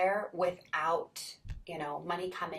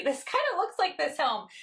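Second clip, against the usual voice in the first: laughing recognition; 11 semitones higher, 6 dB louder than usual. Retold or imitated. Girl recognizing the house.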